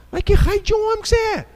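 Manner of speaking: high, indignant preacher's shout; bronca indignada. Real, not acted. Preacher berates cowardly men. (bronca exaltada de pregador, em tom agudo)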